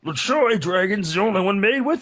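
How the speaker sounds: high, slow commercial pitch voice; ad announcer. Retold or imitated. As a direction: sell it like an old ad. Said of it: "the LaChoy Dragon".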